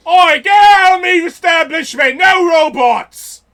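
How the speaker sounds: high, bellowed British pub shout; drawn-out British yell to throw them out; angry British accent. Acted. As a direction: roar it as an outraged British landlord. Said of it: "British accents from inside going like".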